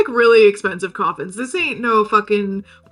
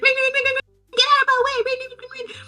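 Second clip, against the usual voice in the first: very high squeaky bell imitation; high-pitched, hammed-up cyclist clearing the path; frantic bike rider; 14 semitones higher, much faster than usual. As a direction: squeal it like a little bicycle bell.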